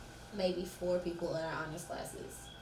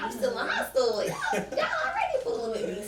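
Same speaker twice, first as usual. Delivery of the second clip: high-pitched, laughing protest; mock disbelief; 6 semitones higher, 4 dB louder than usual. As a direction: act out amused disbelief, pitch jumping up. Quotes his own incredulous reaction.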